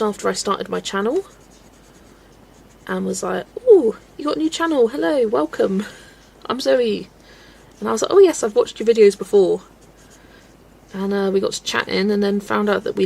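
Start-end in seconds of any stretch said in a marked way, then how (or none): 3.7-7.1 high, loud excited welcome; a high, bright mock of an excited greeting; bubbly greeting. Friend greeting voiced in story. 8.1-9.6 loud, eager answer; a loud, keen retold reply. Own reply voiced in story.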